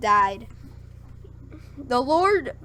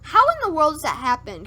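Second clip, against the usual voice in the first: higher, louder, quick disbelief; incredulous question; 5 semitones higher, 6 dB louder, faster than usual. Real, not acted. Reader challenging the flood story, incredulous. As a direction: blurt it out in fast, baffled protest.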